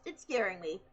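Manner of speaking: ordinary talk, nothing marked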